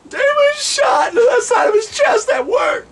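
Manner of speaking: high wailing mock-tearful cry; mock sobbing. Repeating that Dave got shot, wailing in mock grief. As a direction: wail it out, hammed-up grief. Comic mock-tragic announcement.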